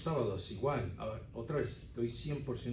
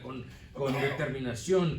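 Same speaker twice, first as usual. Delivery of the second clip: ordinary talk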